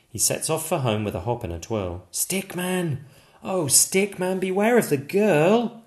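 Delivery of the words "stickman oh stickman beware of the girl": high, sing-song warning call. Acted. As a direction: chant it like a friendly warning. Chanted warning refrain.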